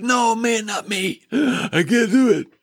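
high, panicky protest with a gasp; frightened Gideon refusing. Retold or imitated. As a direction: squeal out a scared refusal, gasping. Gideon refuses God's call.

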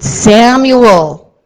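a strong, loud call of the name; loud summoning call. Acted. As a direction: call Samuel firmly across the room. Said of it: "And there's exclamation points".

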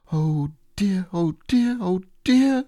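rising panicky "oh dear"; worried. Acted. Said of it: "said Piglet to himself".

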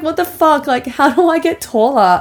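high-pitched mock childhood outrage; exasperated younger self. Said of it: "I was like".

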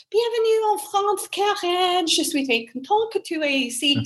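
high-pitched mock French hostess, gliding down; imitated French woman. French woman greeting the new au pair at the airport, high imitated voice. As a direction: gush a welcome in rapid high French, imitating her. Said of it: "this woman arrives, and it was like".

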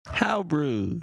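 mock-offended "how rude"; Full House catchphrase. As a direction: imitate an indignant sitcom catchphrase. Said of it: "Is that Full House?".

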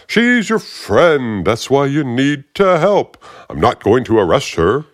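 smug agent relishing bad news; aggravating Fishburne voice. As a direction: savor the threat, drag it out. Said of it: "that really aggravating voice".

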